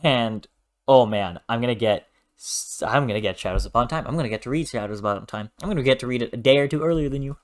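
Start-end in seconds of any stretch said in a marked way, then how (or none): none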